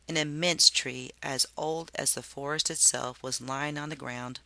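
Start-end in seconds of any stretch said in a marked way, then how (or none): none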